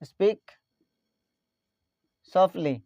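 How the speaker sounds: word by word. Shown as a demonstration. Teacher repeats phrase word by word.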